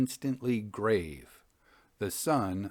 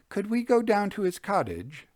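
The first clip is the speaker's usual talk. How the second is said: eager lady's question, pitched much higher; Missus A's voice; 10 semitones higher than usual. A narrator's reading. Missus A asks her young host to visit John, in a much higher lady's voice.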